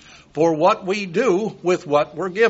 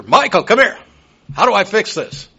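loud, high call across the house; 6 semitones higher, 6 dB louder than usual. Retold or imitated. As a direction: holler for your grandson to come help. Calling grandson over for computer help.

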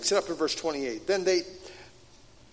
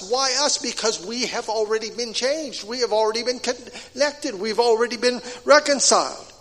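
higher, louder preaching push; fervent preaching; 4 semitones higher, 4 dB louder than usual. Acted. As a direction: preach it out, rising with conviction. Preaching builds up.

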